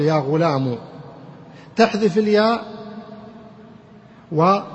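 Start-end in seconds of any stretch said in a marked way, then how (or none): 0.0-0.8 "غلام" ending in u; damma ending. Shown as a demonstration. Teacher replacing ya with damma, "غلام" said ghulamu. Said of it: "ضمة، تقول".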